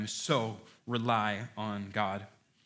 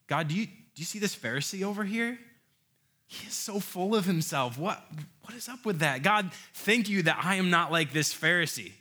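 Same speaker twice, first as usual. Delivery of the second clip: higher, smug self-praising prayer; smug Pharisee; 6 semitones higher than usual. Retold or imitated. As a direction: pray like a proud Pharisee looking down on others. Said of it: "I was like the Pharisee in that story".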